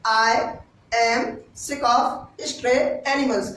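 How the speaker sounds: slow, deliberate teacher repeat; slow model repeat. Shown as a demonstration. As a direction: spell the phrase out slowly for learners. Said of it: "क्या?".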